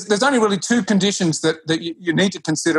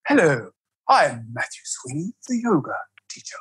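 lower, hushed guru voice, mock-serene; ultra-spiritual yoga teacher voice; 4 semitones lower than usual. Mocking yoga teachers to a podcast host, lower hushed guru voice. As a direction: parody a self-important yoga teacher. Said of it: "my very ultra-spiritual yoga teacher voice".

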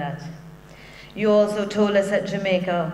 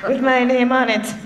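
giggling through a cheeky request, voice lifted; laughing; 5 semitones higher than usual. Real, not acted. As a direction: laugh out the joke as you ask. Joking request for a dress container.

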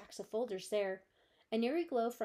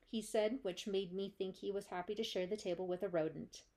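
ordinary delivery

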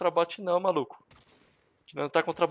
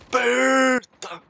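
high, loud, stretched-out cry; long-held gamer scream; urgent yell; 8 semitones higher, 7 dB louder, much slower than usual. Real, not acted. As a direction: drag the middle out in a high shout. Yells in the firefight.

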